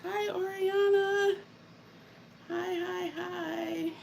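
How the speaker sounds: high, sweet hello; high sing-song greeting. Real, not acted. Greeting viewer by name.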